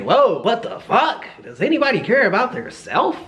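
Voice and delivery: wide-eyed high-pitched shock; stunned disbelief. Retold or imitated. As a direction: act floored by what's happening. Stoned shock at girl's stare.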